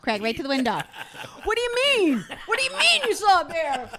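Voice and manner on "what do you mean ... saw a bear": high, loud, hammed-up disbelief; mock-alarmed questioning. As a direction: retell the frantic questioning with comic exaggeration. Retold parent's alarmed reaction.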